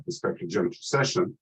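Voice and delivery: ordinary delivery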